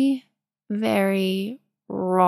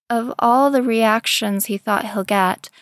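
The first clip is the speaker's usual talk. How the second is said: ordinary delivery, nothing marked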